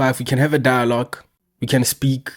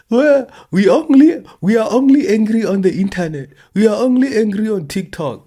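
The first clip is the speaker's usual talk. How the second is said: high-pitched, louder mock voice of online complainers; mocking whiny quote; 9 semitones higher, 5 dB louder than usual. Mocking the people who say they're only angry online, high-pitched and louder. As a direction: sneer it in a squeaky put-on voice. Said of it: "they'll say".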